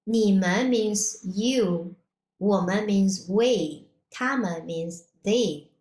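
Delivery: usual speaking voice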